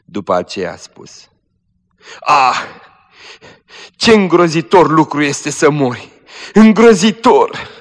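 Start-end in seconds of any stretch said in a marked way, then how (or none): 2.2-6.1 high, loud cry of dread; dying girl cries out in terror; terrified cry. Acted. 6.5-7.7 "Îngrozitor" wailed very high, loud and slow; "Îngrozitor" drawn out high, loud and slow in dread; anguished wail. Acted. Dying girl repeats in terror.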